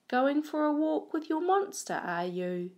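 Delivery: high, teasing shop-woman voice; cake shop woman. Acted. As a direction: tease him like a nosy shop lady. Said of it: "the cake shop woman says".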